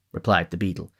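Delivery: ordinary delivery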